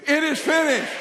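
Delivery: loud, high rallying cry; out-loud declaration. Shown as a demonstration. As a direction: drive it home for the congregation. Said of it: "say it with me".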